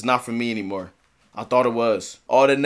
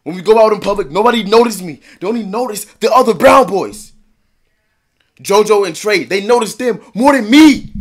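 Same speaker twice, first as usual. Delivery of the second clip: louder and higher, bitter complaint; raised, aggrieved rant; frustrated venting; 6 semitones higher, 8 dB louder than usual. Real, not acted. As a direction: vent the hurt at the world. Venting frustration about being ignored.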